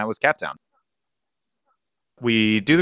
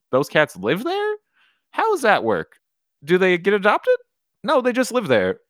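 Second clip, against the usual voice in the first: high-pitched recalled questions, answered to oneself; curious self-questioning; 10 semitones higher than usual. Retold or imitated. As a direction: puzzle it out aloud, pitched up high. Voices own curious questions and answer.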